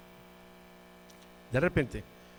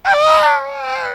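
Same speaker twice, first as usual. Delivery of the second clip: very high, loud wail; piercing acted bawl; imitated child crying; 16 dB louder than usual. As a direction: scream out like a crying girl. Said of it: "Empezó a llorar".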